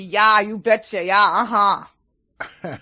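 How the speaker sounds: thick Yooper Michigan drawl, slow and stretched; thick Michigan accent. Shown as a demonstration. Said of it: "if I really wanted to do the accent thick".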